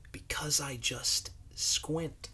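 soft secret whisper; hushed as if letting them in on it. Acted. Mister Lunt shares his hint with the kids, whispered. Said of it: "My eyes are quite teensy".

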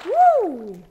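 high loud whoop; gleeful yell mid-jump; excited whoop. Real, not acted. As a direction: let out a thrilled woo on the jump. Jumping into the water pool.

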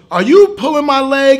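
a mother not buying it; suspicious mother. Pretend. [name] playing the mother calling out the faking kid, loud and high. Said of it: "He goes".

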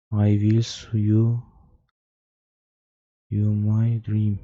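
slow, drawn-out eerie reading; slow spooky reading. Shown as a demonstration. Gamer reading the creepy message slowly, drawn out. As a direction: read it slowly like a ghost whisper. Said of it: "slow dramatic reread".

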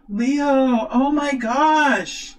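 high, drawn-out astonishment; amazed exclamation. Real, not acted. Tarot reader to Leo on turning a winning card, amazed exclamation. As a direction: let it rise and stretch in wonder. Thrilled by the card just turned.

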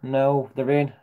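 ordinary talk, nothing marked

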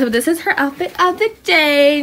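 ordinary delivery

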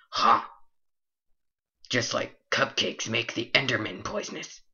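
usual speaking voice

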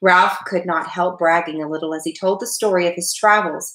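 usual speaking voice

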